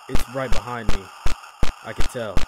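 ordinary delivery

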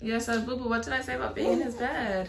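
gentle, soft mommy voice; soft motherese. Real, not acted. Mother soothing her toddler.

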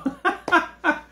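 high, brief burst of laughter. Real, not acted. Storyteller laughing at his childhood worry, amused. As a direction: laugh at your own retold worry.

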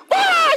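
a sudden high shriek of joy; joyful scream. Retold or imitated. Wife seeing the money online, shrieking with joy. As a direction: shriek out in disbelief. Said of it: "She starts screaming".

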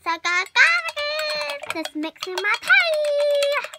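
posh tea voice. Pretend.

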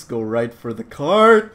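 usual speaking voice

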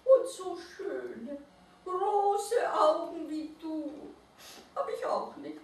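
high, slow, fading to a whisper; shy little mole-rat. A narrator's reading. Mole-rat compliments the owl, high and slow. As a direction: pay the compliment shyly.

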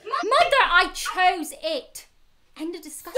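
heated, emphatic outburst; exasperated insistence. Acted. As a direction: lay down the law, stretching each word. Daughter ending the quarrel.

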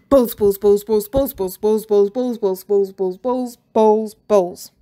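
loud obsessive chant in a strange voice; manic repeating. Acted. Teacher muttering to himself while hurrying, loud manic chant. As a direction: gloat over each ball as you chant. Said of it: "he kept repeating to himself".

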